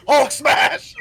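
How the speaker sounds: a laughing mock Hulk roar; mock Hulk shout. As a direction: blurt it out like a dumb brute, laughing. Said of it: "out comes Hulk".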